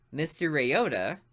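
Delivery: usual speaking voice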